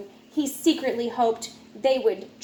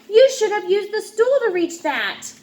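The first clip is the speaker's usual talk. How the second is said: scolding; 8 semitones higher, 4 dB louder than usual. Acted. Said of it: "yelled his mom".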